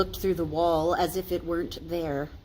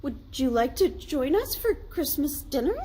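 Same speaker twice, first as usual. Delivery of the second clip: higher, hopeful; pitched up invitation; eager invite; 9 semitones higher than usual. A narrator's reading. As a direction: offer it warmly. Bob invites Scrooge.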